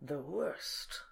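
slow, low, dark delivery; ominous. Acted. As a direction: savour the name like a villain. Ominous name reveal.

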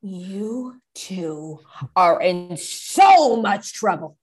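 low, very slow parental threat; ominous mother laying down the law; stern scolding. Acted. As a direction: draw out each word menacingly.

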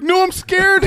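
mock scared. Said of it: "have you ever tried accents and you're like".

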